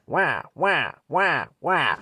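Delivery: high, loud wailing wah wah like a cartoon baby; crying baby siren. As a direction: imitate an old ambulance siren as a crying baby.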